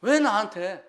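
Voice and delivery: high, loud cry of protest; retold shout to God. Preacher voices suffering Job crying to God, high retold shout. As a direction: imitate Job yelling up at God. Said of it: "하나님을 향해서 막 소리 지릅니다".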